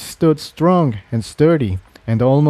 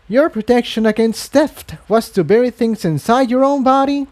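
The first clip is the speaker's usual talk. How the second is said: incredulous rising question, much higher; disgusted; 11 semitones higher than usual. Acted. Unicorn disgusted at the implant. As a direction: recoil in disgust. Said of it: "a look of disgust".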